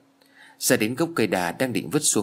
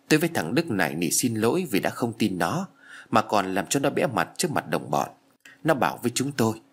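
ordinary delivery, nothing marked

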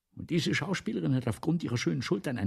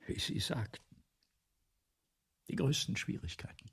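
whisper; 2 semitones higher, 6 dB quieter than usual. Acted. Writer echoes Brissot's words.

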